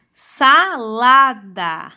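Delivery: very slow, each syllable stretched; sílabas separadas. Shown as a demonstration. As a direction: sound out Salada as SA-LA-DA, slowly. Teacher sounds out word by syllables. (leitura silabada, bem devagar)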